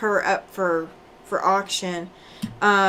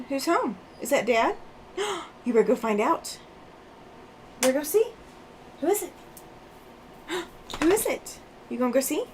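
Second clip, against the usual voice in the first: higher, sing-song voice for the dog; talking to the puppy; 4 semitones higher, 4 dB quieter, faster than usual. Real, not acted. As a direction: coax the puppy to go and look.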